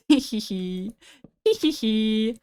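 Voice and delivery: playful drawn-out "hee hee hee"; cute giggle. Pretend. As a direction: giggle sweetly and slowly. Playful giggle after blown kiss.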